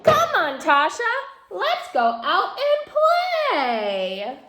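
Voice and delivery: high, slow, eerie little doll calling; spooky doll voice. A narrator's reading. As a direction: lure her out to play in a sing-song doll voice. Doll voice in story.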